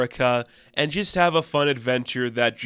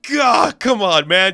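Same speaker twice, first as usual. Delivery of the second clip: much higher, louder groan of disbelief; real fond exasperation at a bad pun; amused exasperation; 8 semitones higher, 8 dB louder, slower than usual. Real, not acted. Exasperated at goofy joke.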